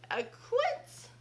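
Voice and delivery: high, loud puzzled mishearing; mishearing fairy. Acted. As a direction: chirp the wrong word brightly. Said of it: "said the fairy".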